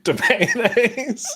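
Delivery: high, slow, giggling; laughing pun. Real, not acted. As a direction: squeak it out laughing.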